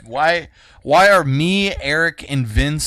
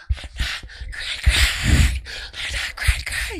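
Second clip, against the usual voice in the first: put-on strained, voiceless little-girl rage; mock furious little girl; slower than usual. Small kid snapping back at a teaser, imitated mock fury. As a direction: rasp it out, dragging the words.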